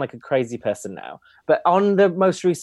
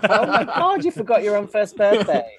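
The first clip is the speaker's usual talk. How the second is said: much higher, laughing disbelief; shocked laugh; 7 semitones higher, 4 dB louder than usual. Real, not acted. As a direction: gasp it out, giggling.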